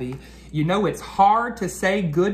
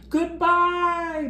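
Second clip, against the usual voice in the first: put-on high goodbye called after the kids; 3 dB louder than usual. A narrator's reading. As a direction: call after the departing students. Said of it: "Missus Hartwell called after them".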